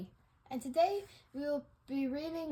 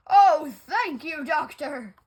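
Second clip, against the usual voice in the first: grateful burst; 11 dB louder than usual. Acted. Grateful to the doctor.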